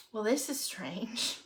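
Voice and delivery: quiet, slow puzzled musing; musing to self. Retold or imitated. Speaker recalls noticing something odd on day seven, musing. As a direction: wonder it softly to yourself. Musing to herself about new energy.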